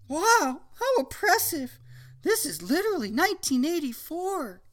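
put-on young woman voice. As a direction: greet him like a sweet girl. Phoebe voiced.

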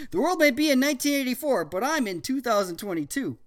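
put-on teen boy voice. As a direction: play a smug cool teen.

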